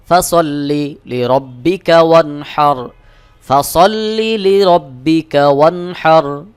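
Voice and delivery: slow melodic tajweed chant; ধীর সুরেলা তিলাওয়াত; Quran recitation. Shown as a demonstration. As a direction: draw out every vowel in recitation. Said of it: "একটু চাপ দিয়ে উচ্চারণ".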